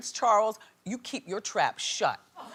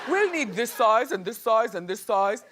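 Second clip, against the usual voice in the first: put-on a light English accent, laughing; mock English accent; 4 dB louder than usual. Imitating the stylist's English accent while shopping. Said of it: "he's got an English accent".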